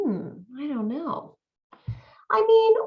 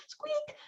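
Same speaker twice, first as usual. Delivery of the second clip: very high, soft mouse squeak; a thin frightened squeak; tiny squeak; 11 dB quieter than usual. Acted. Mouse squeaking mid-request to the lion, tiny and high.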